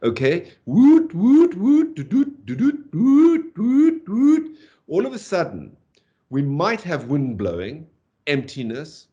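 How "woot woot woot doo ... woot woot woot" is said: high, loud rising whoops; piercingly high "woot" notes sweeping upward; emphatic bird call. Shown as a demonstration. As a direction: whoop out a territorial birdsong in spring. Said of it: "the kind of call a bird establishing its territory".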